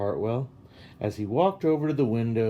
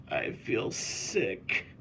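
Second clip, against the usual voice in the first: lower, softer, slow weak voice; weakly; 4 semitones lower, 6 dB quieter, much slower than usual. A narrator's reading. Nervous girl in the car telling her father, weak and soft. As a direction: barely get it out. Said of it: "said Sarah weakly".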